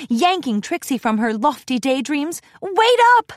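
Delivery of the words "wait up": loud high call to wait; shouting. A narrator's reading. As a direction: shout after her to wait. Calling after a friend.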